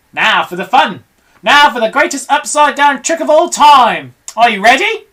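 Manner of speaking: loud, high, thrilled ringmaster cry; showman's announcement. Acted. Mugglewump hyping his crowd, loud and thrilled. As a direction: build it up like a circus showman.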